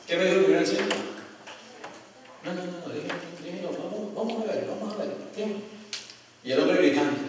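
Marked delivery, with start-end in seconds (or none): 2.4-5.6 soft, placating mock reply; hushed dismissal. First elder asked to remove the preacher, hushed dismissal. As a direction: imitate an elder softly brushing it off. Elder declines to intervene, retold.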